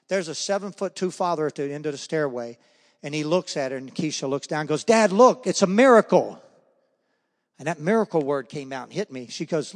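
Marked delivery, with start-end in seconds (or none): 4.8-6.4 high, loud, thrilled cry; excited call. Retold or imitated. Daughter calling down to her father, loud and thrilled. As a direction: announce a miracle to your dad.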